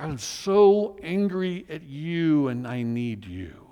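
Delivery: slow, aching plea to God; lamenting prayer. Shown as a demonstration. Pastor voicing a lament to God, slow pained plea. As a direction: pour out anger and need at once, slowly. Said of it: "that's what lament is".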